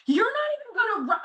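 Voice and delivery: high, fast angry outburst; a retold snapping outburst cut off midword; lost it. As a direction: blurt it out, losing your temper. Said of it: "oh God, I just lost it again".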